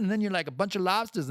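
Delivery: usual speaking voice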